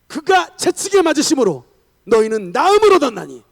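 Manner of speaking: loud, high preacher's proclamation; ringing declamation of a Bible verse; shouted scripture. Acted. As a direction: cry the verse out with full force. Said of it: "한 시간 동안 외쳤던 말씀".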